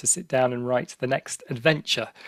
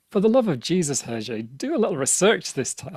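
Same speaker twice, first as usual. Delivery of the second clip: a light Irish lilt on the priest's plea; lightly voiced Irish chaplain, high on love; Irish accent; 4 semitones higher than usual. Chaplain imploring Hergé, in a light Irish accent. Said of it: "I don't know why he's speaking in an Irish accent".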